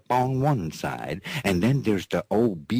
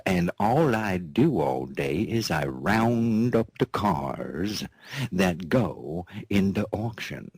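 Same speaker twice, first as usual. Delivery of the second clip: ordinary delivery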